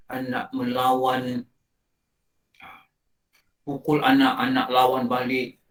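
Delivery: ordinary talk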